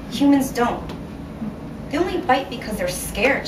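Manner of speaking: usual speaking voice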